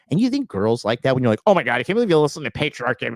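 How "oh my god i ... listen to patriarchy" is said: rapid-fire mocking whine; mock complaining. Imitating a complaining man whom girls won't like, mock whining. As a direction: gabble it as a hammed-up complainer. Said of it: "crying and complaining".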